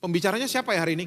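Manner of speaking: fast offhand mock question; rushed, casual imitated churchgoer; quick mock question. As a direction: toss it off quickly. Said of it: "nggak akan lihat pembicara".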